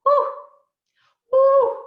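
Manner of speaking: sharp, high "woo" bursts; loud whoop. Real, not acted. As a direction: let out two separate high woos. Exhales after painful crying story.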